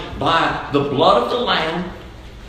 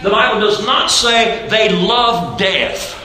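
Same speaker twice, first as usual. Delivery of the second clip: emphatic sermon, long stretched key words; raised, ringing preacher's proclamation; full-force preaching; 7 semitones higher, 4 dB louder than usual. Real, not acted. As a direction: drive the point home to the congregation.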